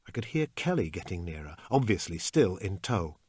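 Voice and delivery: ordinary delivery, nothing marked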